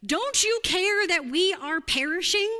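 frantic, loud, high-pitched appeal; yelling. Acted. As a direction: call out loudly to wake someone in a storm. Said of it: "they finally yell at Jesus".

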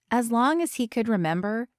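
ordinary delivery, nothing marked

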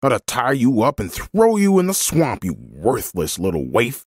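angry threats. A narrator's reading. Man threatening a child on his property, angry. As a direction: threaten the little trespasser in a temper.

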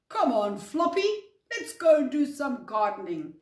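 loud, cheery rallying call; eager call. Acted. Ginger calling Floppy out to do some gardening, eager call. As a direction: rouse a friend to come along.

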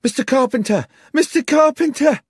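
high, loud cries of alarm; frantic screaming. Acted. Young woman bursting into the bar for the landlord, screaming. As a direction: cry out for help in a panic. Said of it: "came screaming into the bar".

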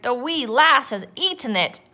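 yelled. A narrator's reading. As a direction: yell it as an aghast captain. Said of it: "yelled Braidbeard".

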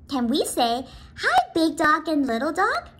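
ordinary delivery

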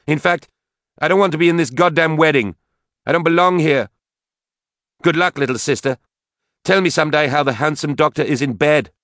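high, loud outburst; enraged, bitter Katie. A narrator's reading. Drunken daughter quits her sister's wedding, bitter outburst.

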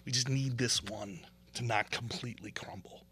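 mock-sheepish whispered plea; sarcastic whisper. Real, not acted. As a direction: whisper it as if afraid to ask.

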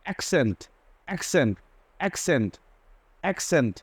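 অ্যাকসেন্ট said খ then স. Shown as a demonstration. Teacher showing both sounds of the double c. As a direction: split অ্যাকসেন্ট into খ and স. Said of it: "দুই ধরনের উচ্চারণ হয়েছে".

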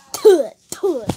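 two loud "tuh" spit noises; mock spitting. Mocking the French attempt with loud spitting sounds. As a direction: spit it out sharply, twice. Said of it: "That's like tuh".

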